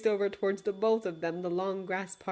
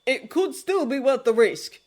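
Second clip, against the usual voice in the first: arguing; 5 semitones higher, 8 dB louder than usual. Acted. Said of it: "Hob argued".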